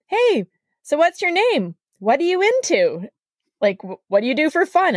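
high, chirpy pickup voice; eager flirt. Retold or imitated. Shirtless guy at the sink hitting on her, chirpy. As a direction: come on keen and cheesy.

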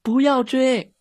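put-on high, loud child's cry; shouting. A narrator's reading. Girl yelling at the dog chasing a cat, shouting. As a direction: yell out in alarm, pitched high. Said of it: "米莉大叫".

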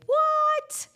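loud, very high squeal of disbelief; mock astonishment. Host reacts to Spunky Old Broads Day, mock astonishment. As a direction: shriek it playfully, as if stunned.

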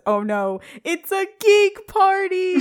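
drawn-out, high, loud echo imitation; mock echoey effect. [name] realizes what party he is at, mock echoey dread. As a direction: stretch it out and up like a dramatic echo. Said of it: "like, a weird echoey effect".

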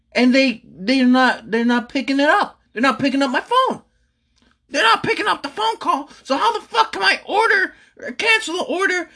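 real exasperation cracking upward; exasperated high rant. Real, not acted. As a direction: voice climbing high in disbelief, half laughing. Ranting, pitch climbs high.

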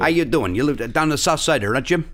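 hammed-up Chicago local; Chicago accent. Local greets Jim from the south side, thick Chicago accent. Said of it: "It sounds like Mike Ditka".

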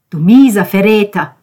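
loud, bitter outburst; enraged at a betrayal; angry accusation. Acted. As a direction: hurl the insult at him. Ben accuses Konrad of betrayal.

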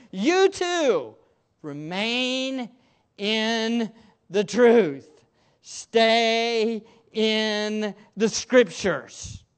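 rising preacher's appeal, drawn out on the final words; fervent exhortation. Real, not acted. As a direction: plead with the congregation to stay in the truth.

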